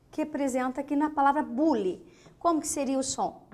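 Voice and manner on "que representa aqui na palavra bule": normal talking voice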